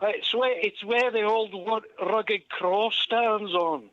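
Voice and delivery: high Scottish comic voice; high-pitched Scottish joke-telling; Billy Connolly. Retold or imitated. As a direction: tell it in a broad Scottish accent. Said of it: "I tend to turn into Billy Connolly".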